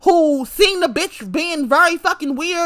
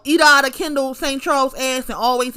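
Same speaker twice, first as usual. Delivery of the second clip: ordinary talk, nothing marked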